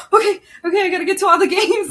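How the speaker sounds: gushing like an eager baseball mom; excited. Retold or imitated. Mother recalls her son joining high school baseball, excited and fast. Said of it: "I was so excited".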